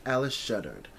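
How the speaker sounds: ordinary delivery, nothing marked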